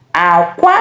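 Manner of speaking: "Akwa" low, then rising; egg tone. Shown as a demonstration. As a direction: start "Akwa" low and jump up on the second syllable. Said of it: "different pronunciation".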